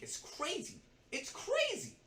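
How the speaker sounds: squeaky, very high disbelief; incredulous. Retold or imitated. Assistant asked to learn accounting in a week, incredulous. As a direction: let the voice leap up in disbelief. Incredulous retort at unreasonable demand.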